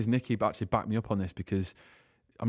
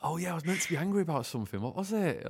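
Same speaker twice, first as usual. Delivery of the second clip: cat voice; 7 semitones higher, 4 dB quieter than usual. Retold or imitated. Talking sweetly to her cat.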